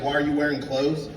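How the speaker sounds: ordinary talk, nothing marked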